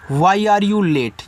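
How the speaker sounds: slow and clear question; slow model sentence. Shown as a demonstration. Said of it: "इसको आप use करोगे". (धीमा उदाहरण प्रश्न)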